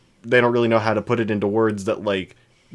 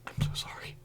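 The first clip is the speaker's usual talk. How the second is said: softly whispered sorry; whispered apology; 16 dB quieter than usual. Retold or imitated. Apologizing to the embarrassed customer, in a whisper. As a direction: breathe the apology under your breath.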